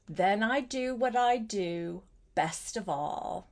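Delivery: ordinary delivery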